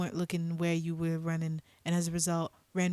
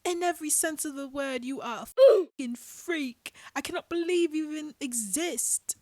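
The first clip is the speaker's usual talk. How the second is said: an outraged British rant at Paul and John; furious British accent; 9 semitones higher than usual. Acted. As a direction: tear into both men in a British accent. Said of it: "British accent".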